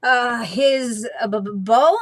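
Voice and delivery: halting, caught-out stammer; stammering in surprise. A narrator's reading. Sisters caught off guard, stammering in surprise. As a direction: stumble over the words, flustered. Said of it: "they stammered in surprise".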